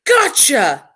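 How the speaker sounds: loud, high monster yell; dinosaur yelling. A narrator's reading. Dinosaur in the woods yelling at its prey, loud. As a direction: shout it like a pouncing dinosaur. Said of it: "a dinosaur yelling".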